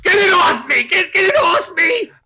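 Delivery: high shrieking panic; panicked scream. Pretend. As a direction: scream to get it off. Creature on him, screaming.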